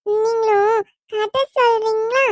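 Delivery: put-on high, slow little girl voice; child voice. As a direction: speak like a small child asking for a story. Child voice asking father. (குழந்தைக் குரல்)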